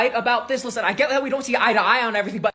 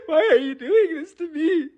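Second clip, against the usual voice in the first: high-pitched, half-crying wail; hammed-up tearful whine; distraught whining; 5 semitones higher than usual. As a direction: plead as if close to tears. Teased partner breaks down in quarrel.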